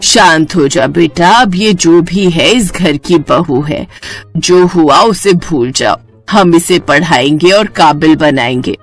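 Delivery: calming elder parent. Acted. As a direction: settle the quarrel and stand by the bride. (बेटे को समझाती शांत आवाज़)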